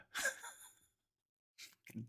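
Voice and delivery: breathless laugh, whispered word. Real, not acted. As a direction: laugh and gasp out dying. Reacts to retold joke.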